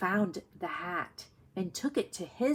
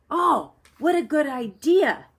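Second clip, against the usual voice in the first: higher, louder happy exclamation; delighted Toad; 8 semitones higher, 10 dB louder than usual. Acted. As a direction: burst out with delight at the idea.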